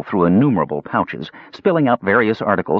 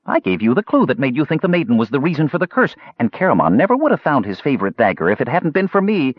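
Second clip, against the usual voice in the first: high-pitched kender pleading his case; small eager voice, insisting and chattering; earnest kender; 6 semitones higher than usual. A narrator's reading.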